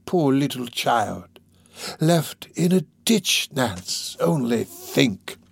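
slow, drawn-out wheedling; mock pity. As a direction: linger on each phrase to work on her.

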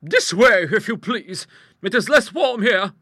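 high, loud, assured character voice; big-voiced matter-of-fact lady; strong-willed hostess. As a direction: explain it heartily and without concern. Hostess directing her visitors.

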